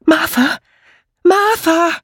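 hohes, lang gezogenes Rufen; rufend. Acted. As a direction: rufe die Freundin schrill über den Hof. Ruft die Freundin von weitem. (laut nach jemandem rufend)

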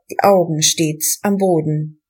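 plain reading voice

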